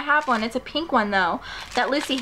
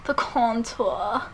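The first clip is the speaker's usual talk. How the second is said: slow, softer, put-on posh pronunciation of "The contour"; mock fancy; 4 dB quieter, much slower than usual. Mocking her own fancy word to viewers, drawn out slowly and softly. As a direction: stretch "The contour" out slowly like a snooty beauty guru. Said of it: "why did I say that all fancy?".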